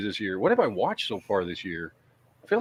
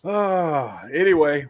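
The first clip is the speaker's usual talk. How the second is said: ordinary talk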